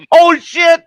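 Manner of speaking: very high, loud imitation of panic; mock panic. Manager realizing she has no mask, mock panicked yelp. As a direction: squeal it high and loud, imitating someone caught out.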